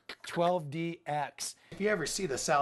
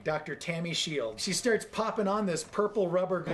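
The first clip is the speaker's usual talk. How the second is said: usual speaking voice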